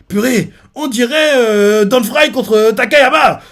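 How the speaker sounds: lent, aigu et fort, presque crié; s'emballer devant le combat; commentaire excité. Retold or imitated. (exclamation criée, lente et aiguë)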